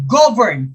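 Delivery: GOV punched in "govern", second syllable falling away; first-syllable stress. Shown as a demonstration. Teacher sounding out an option for students, stress on first syllable. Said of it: "first syllable".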